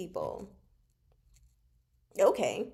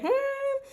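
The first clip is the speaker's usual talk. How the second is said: 1 mock hmm; 4 dB louder than usual. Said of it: "my notes be like".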